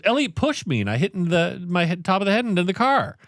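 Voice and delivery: put-on high-pitched tattling child; mock an upset kid telling on his brother; imitated complaining kid. Dad imitating hurt son blaming Elliot, high mock complaint.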